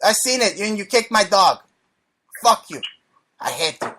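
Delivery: mock-hurt caricatured Chinese accent; Chinese-accented complaint, dropping away at the end. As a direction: air a grievance in a put-on Chinese accent. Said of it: "You do Chinese accent pretty good".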